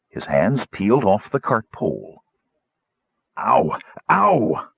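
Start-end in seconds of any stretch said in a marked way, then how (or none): 3.4-4.7 rising yelps of pain; yelp, the second cry much higher; pained whimper. A narrator's reading. Gnome as his hands peel off the pole, pained whimper. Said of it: "Roperig whimpered".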